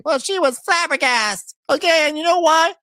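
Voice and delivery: high, loud put-on voice; mock Portia. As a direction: camp it up as the gossiping housewife. Voicing Portia in a quarrel.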